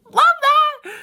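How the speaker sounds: very high, loud mock delight; loud fake enthusiasm; sarcastic outburst. Sarcastic reaction to the cost.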